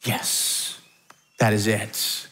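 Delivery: soft, breathless whispered yes, yes; hushed excited yes. Retold or imitated. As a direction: whisper it like you can't contain the thrill. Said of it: "And we're like".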